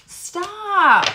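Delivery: sudden high, loud startled cry; surprised stop. Real, not acted. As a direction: blurt it out in shock. Startled by cards while shuffling.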